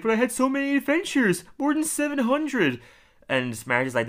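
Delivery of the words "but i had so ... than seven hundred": very high, pleading mock voice; retold bewildered protest. Homer told he was in a coma, high mock protest. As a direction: protest in disbelief, pitched right up.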